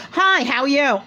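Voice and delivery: high breathy [name] greeting, mock; imitating [name]'s high hello; [name] impression. Retelling [name] greeting her guests, imitated high. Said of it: "how I'm making her voice is how".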